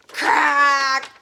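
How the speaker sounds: loud strained yell; robot straining to smash; mock effort shout. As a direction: grunt it out with force.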